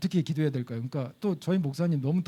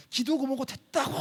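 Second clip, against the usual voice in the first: angry brush-off; 8 semitones higher than usual. Retold or imitated. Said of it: "막 그러면서".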